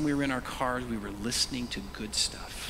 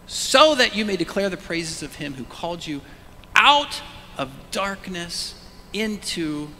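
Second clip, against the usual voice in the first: proclaimed; 6 semitones higher, 7 dB louder than usual. Real, not acted. Preacher proclaims scripture.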